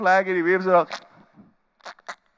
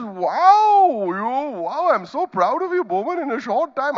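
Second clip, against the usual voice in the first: put-on old uncle praising; 9 semitones higher, 4 dB louder, slower than usual. Voicing the old photographer's praise.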